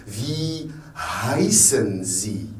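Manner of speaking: slow "Wie heißen Sie?" with breathed h; H pronounced. Shown as a demonstration. Teacher modelling the German question, H pronounced. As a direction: sound the h clearly in "heißen". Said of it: "on prononce le H".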